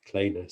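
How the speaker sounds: Kleene said as "KLAY-nuh"; correct pronunciation. Shown as a demonstration. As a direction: say Kleene with an "ay" vowel and an "uh" ending. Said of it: "never hear anybody say".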